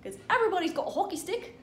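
high, fast hammed-up Canadian accent, big rising eh; mock Canadian accent. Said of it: "apparently that's Canadian".

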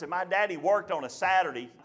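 ordinary delivery

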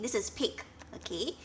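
ordinary delivery, nothing marked

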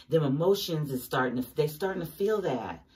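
ordinary talk, nothing marked

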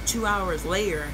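ordinary talk

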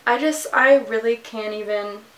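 normal talking voice

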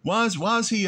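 high, incredulous whine; mock incredulous. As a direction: squeak it out in disbelief. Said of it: "I remember having this thought".

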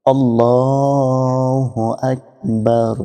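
slow chanted আল্লাহু আকবার; takbir recitation. Shown as a demonstration. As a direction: call it out slowly like in prayer. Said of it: "রুকুতে যাওয়ার সময় বলবো". (নামাজের সুরে ধীরে তাকবির)

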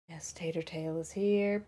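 soft unvoiced whisper to the cat; cooing whisper to a pet. Real, not acted. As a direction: hush it like the cat is sleeping.

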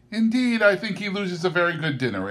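high, quick interjection; interrupting. A narrator's reading. Niece cutting in on her husband at the dinner table. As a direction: cut in eagerly. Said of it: "interrupted Scrooge's niece".

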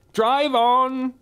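very high, loud command; shouted order. Acted. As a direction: bark the order to drive. Witch orders driver.

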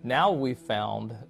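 ordinary talk, nothing marked

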